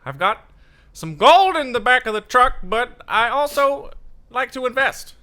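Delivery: very high, loud prospector voice; hee-haw prospector. Acted. Co-host joining the impression of host's dad, high prospector voice. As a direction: push it way up high, stretching the phrases. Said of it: "a hee-haw prospector voice".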